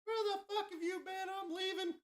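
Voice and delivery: very high, shrill-pitched mock angry woman; mock angry girl. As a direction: squeak out an angry girlfriend's scolding. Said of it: "little angry sushi girl comes out of there like".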